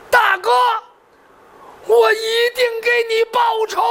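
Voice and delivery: high, anguished cry of vengeance; grief-stricken vow. Acted. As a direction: cry out the oath in a high, strained voice. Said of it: "黄天霸当时泪如雨下". (悲痛高喊，立誓报仇)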